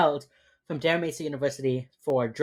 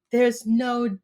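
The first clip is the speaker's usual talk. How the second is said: higher, louder exasperated retort; hammed-up disbelief; mock incredulous; 5 semitones higher, 4 dB louder, slower than usual. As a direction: imitate someone baffled by nonsense. Acting out John rebutting her claim.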